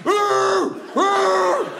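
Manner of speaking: high squealing ooh; high mock moan. Man on the bed squealing before it happens, high and hammed-up. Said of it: "this fella goes".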